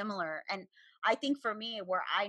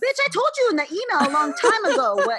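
high, loud mock tirade; exasperated outburst; 6 semitones higher, 8 dB louder than usual. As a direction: act out fed-up telling-off at full volume. Voicing women's real meaning behind emails.

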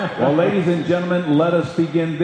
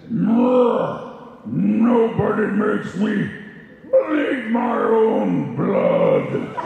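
slow, growling mutant shout, higher; Fox the super mutant; 4 semitones higher, much slower than usual. Acted. [name] voicing Fox the super mutant at the panel, slow mutant shout. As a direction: roar it out like a raging super mutant. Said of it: "How about Fox, the super mutant".

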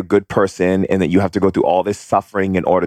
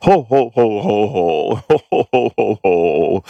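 put-on belly-laugh like a jolly old man, pitched up; Santa Claus laugh; 2 semitones higher than usual. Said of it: "it's literally Santa Claus laughing".

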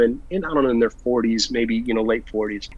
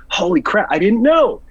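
higher, louder retold dismay; stunned realisation; 6 semitones higher, 4 dB louder than usual. Teller realising whom he insulted, stunned dismay. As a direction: climb in pitch as the blunder lands.

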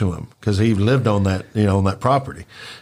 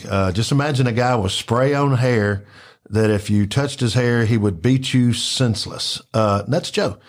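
ordinary talk, nothing marked

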